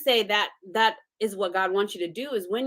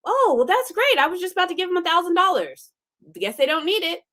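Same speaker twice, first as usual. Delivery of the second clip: much higher and quick, airy; mock-cheerful benefactor; 10 semitones higher than usual. A would-be giver shrugging off the gift, high and airy. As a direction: chirp it brightly while taking the offer back.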